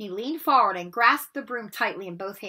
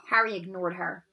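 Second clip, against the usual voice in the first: ordinary delivery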